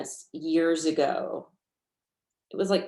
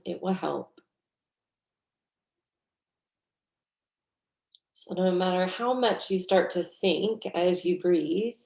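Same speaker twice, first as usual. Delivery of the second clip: guided meditation. Real, not acted. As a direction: guide them gently through the breath, unhurried.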